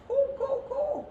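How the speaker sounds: high squawking bird calls; penguin caws. Acted. Narrator squawks as the penguins crash.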